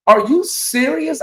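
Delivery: high and drawn out, amazed; overjoyed fan asking if the news is real; thrilled disbelief. Real, not acted. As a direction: savour the surprise slowly.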